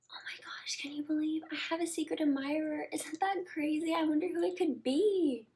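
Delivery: high whispered gossip; excited whisper. Retold or imitated. Girl whispering to her date about a secret admirer, giddy.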